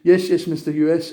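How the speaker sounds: hammed-up servile agreement; mock yes-man. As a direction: grovel like a lapdog saying yes to a boss. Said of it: "jump to attention and go".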